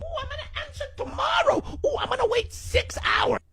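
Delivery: high, squeaky, laughing voice; squeaky texter voice. Retold or imitated. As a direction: play coy in a squeal. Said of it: "so many games associated with texting".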